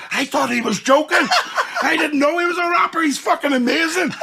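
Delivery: high, loud, excited gush; amazed fan. Retold or imitated. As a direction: rave like a stunned fan.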